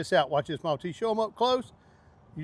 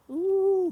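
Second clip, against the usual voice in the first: long, high-pitched ooh; delighted ooh; 11 semitones higher than usual. Real, not acted. Cook watching his pot, delighted high ooh. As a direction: let out a high, held ooh.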